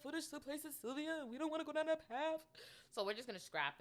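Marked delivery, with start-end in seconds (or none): 0.0-2.4 high, quick put-on voice; mock dainty. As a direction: say it in a playful high-pitched rush. Putting on a silly voice.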